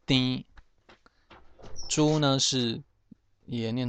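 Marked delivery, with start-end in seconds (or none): 0.1-0.4 丁 as Hokkien "tēnn", nasalised vowel; nasal vowel. Shown as a demonstration. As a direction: say 丁 with the vowel through the nose and no final ng. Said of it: "鼻音丁".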